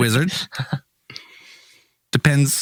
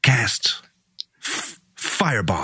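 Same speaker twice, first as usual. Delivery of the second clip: halting, sinking delivery with odd pauses; Christopher Walken; slower than usual. Acted. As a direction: break it up and slow down on the last word. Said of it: "except you're Christopher Walken".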